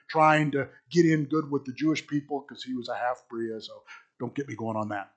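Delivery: ordinary talk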